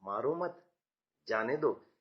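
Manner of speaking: soft मारो मत rising, break, low जाने दो; pause after मत. Shown as a demonstration. Teacher giving the second sentence to a class, pause after मत. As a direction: join मारो मत gently, pause, then जाने दो. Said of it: "लेकिन दूसरे वाक्य में".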